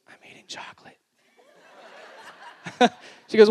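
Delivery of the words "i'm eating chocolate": put-on hushed guilty child whisper; secretive little whisper. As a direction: whisper it like a caught toddler. Toddler caught hiding, whispering.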